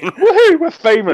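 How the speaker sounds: high, loud laughing woohoo; mock-triumphant laughing cheer at getting famous, high and loud.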